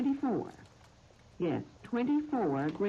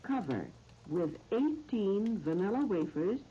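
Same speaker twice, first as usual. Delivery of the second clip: slow, measured phrases with long pauses; recipe dictation; slower than usual. Real, not acted. Radio host reading out a recipe to listeners, slow dictation. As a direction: dictate it piece by piece, leaving time to write.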